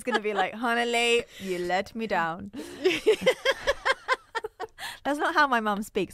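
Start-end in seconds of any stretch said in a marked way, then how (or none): 0.5-2.5 lightly posh, slow disappointed mum; a hint of a posh disappointed mother; posh English accent. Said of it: "I do an extra posh accent when I do my mum's voice". 2.5-5.0 high giggle after an impression; giggling laugh. Real, not acted.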